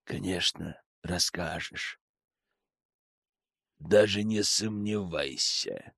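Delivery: slow, low, quiet threat; menacing low voice. Acted. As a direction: lean in and threaten slowly. Frank's calm menace.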